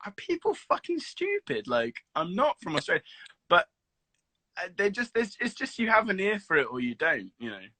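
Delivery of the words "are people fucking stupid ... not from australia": high, exasperated mimic of his mum; mock the mum's outrage, pitched way up; imitating exasperated mum. Mum fed up with being called Australian, mock exasperation. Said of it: "She was like".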